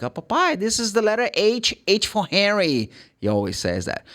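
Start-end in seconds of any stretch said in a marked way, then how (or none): 0.0-2.9 high-pitched imitation of his little son; higher child-like voice; imitating his son. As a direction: play the son proudly showing papa a letter, high voice. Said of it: "He goes like".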